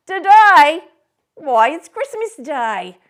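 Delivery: loud high lad's reply; street boy. Acted. The boy answering Scrooge from the street, cheeky. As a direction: call back up cheekily.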